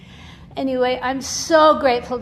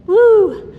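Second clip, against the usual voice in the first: sudden high cheer, much louder; excited whoop; 9 semitones higher, 14 dB louder than usual. Real, not acted. Cheering her granddaughter's name, loud whoop. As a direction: let out a joyful woo. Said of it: "cheering the baby's name".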